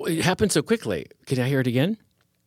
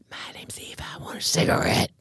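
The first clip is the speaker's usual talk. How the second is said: ghost whisper; 3 dB quieter than usual. Pretend. Joke ghost option, whispered.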